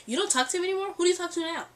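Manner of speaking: high, loud imitation of a pushy friend; mock interrogation, loud and insistent; aggressive questioning. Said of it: "aggressive with it".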